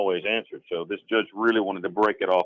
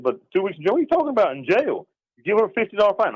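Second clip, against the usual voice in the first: retold angry protest, pitched way up; indignant, high and louder, disputing the sentence; 愤怒质问; 11 semitones higher, 4 dB louder, faster than usual. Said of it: "I got angry".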